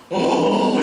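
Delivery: a sudden loud demonstrative sob; loud sob sound. Shown as a demonstration. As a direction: burst out in one loud wail. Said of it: "이렇게 운 적은 없어요".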